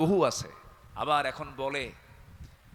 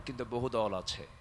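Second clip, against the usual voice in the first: ordinary talk, nothing marked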